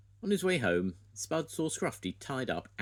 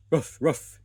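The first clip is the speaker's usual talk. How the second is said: loud playful woof woof; dog barks; 8 semitones higher, 11 dB louder than usual. A narrator's reading. Grandad barking as Scruffy jumps up at Spud, loud. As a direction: bark it out like an excited puppy. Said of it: "he barked".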